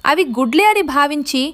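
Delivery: plain reading voice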